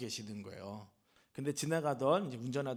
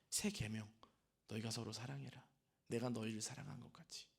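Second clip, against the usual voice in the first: hushed quote; 5 semitones lower, 12 dB quieter than usual. Acted. Quoting Jesus, hushed.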